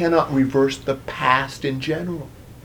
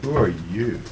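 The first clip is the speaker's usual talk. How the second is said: low, soft, sinking question; hushed, lowered voice of a father's dawning dread; sick dread; 6 semitones lower, 7 dB quieter than usual. Acted. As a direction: ask it quietly as dread sets in. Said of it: "gets this sick feeling".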